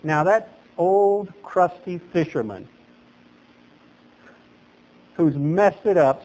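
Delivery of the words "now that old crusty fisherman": ordinary talk, nothing marked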